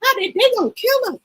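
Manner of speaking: high, startled exclaim; shocked. Real, not acted. Vlogger shocked at egg prices, voice jumping high. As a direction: react to the price in disbelief.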